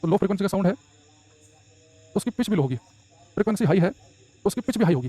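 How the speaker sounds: slow, measured dictation pace, words spaced out. Shown as a demonstration. Teacher dictating notes to students, slow and measured. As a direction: read it out slowly so the class can write.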